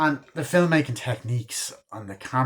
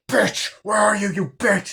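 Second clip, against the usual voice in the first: high, loud furious yell; furious phone yell; 10 semitones higher, 5 dB louder than usual. Retold or imitated. Sidney yelling at the killer on the phone, furious. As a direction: snap at the killer like you're done being scared.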